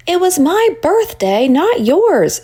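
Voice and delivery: put-on high, pouty little-girl voice; frowning little sister. A narrator's reading. Little sister Annie snaps at Sarah, high and pouty. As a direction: stake your claim like a sulky seven-year-old.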